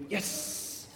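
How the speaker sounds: hushed, hissed little cheer; softly whispered celebration, restrained excitement; a bit excited. Shown as a demonstration. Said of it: "a bit more excited".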